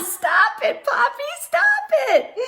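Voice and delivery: high, giggling shriek rising on the second stop; laughing yell. Acted. Child chased by Poppy with the hose, laughing shriek. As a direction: squeal it while being chased with the hose. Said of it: "and I yell".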